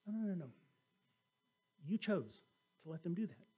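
ordinary talk

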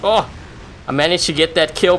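ordinary delivery

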